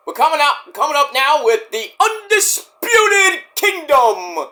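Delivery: high, slow, drawn-out ring announcer call. Pretend. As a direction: announce them like the arena voice. Announcing the faction's entrance.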